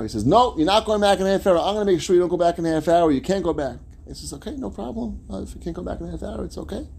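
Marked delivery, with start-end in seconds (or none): 0.2-3.8 loud, high, bossy refusal; hammed-up scolding in a raised voice; acted provocation. Acting helper provoking the waiting man. 4.3-6.9 soft, high, placating voice; playing a mild, agreeable man; acted easygoing acceptance. Patient man accepts the refusal.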